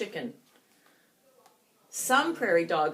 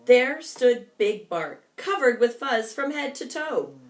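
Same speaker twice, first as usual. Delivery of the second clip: plain reading voice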